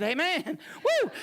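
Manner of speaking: loud, high amen breaking into a whoop; Baptist-style exclamation. Acted. Minister playfully getting Baptist mid-sermon, exuberant shout. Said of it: "I almost got Baptist and said".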